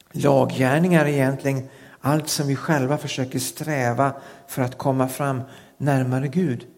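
ordinary talk

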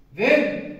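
sudden high, loud "Vem?"; exclaimed question. Acted. Preacher asking the congregation, sudden loud cry. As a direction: throw the question at the room. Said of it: "vem säger detta?".

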